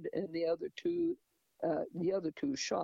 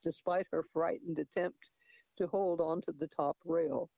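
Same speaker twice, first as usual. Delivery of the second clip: usual speaking voice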